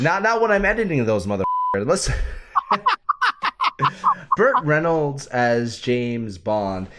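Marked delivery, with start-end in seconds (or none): 2.2-4.3 high laugh. Real, not acted. Host cracks up over editing the videos, high laughter.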